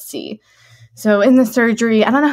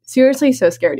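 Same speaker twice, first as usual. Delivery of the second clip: normal talking voice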